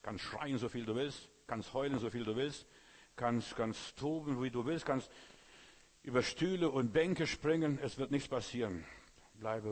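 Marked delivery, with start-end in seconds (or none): none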